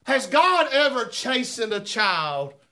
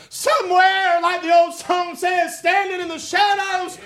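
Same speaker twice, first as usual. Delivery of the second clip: high, loud testimony building to a shout; full-force preaching; 10 semitones higher, 5 dB louder than usual. Real, not acted. Preacher testifies of being on the edge and rescued, shouting fervently. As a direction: preach it at the top of your range, stretching the big words.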